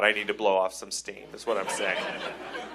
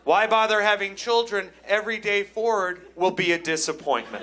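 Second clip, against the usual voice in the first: high-pitched euphoric raving; crazed elation; 7 semitones higher than usual. Acted. Fan after the championship win, crazy overjoyed declaring. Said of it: "I'm saying crazy things".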